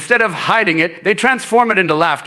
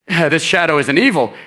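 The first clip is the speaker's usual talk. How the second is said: usual speaking voice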